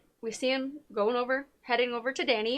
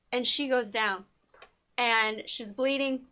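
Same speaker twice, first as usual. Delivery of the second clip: normal talking voice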